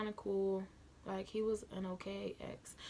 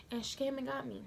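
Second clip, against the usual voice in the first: usual speaking voice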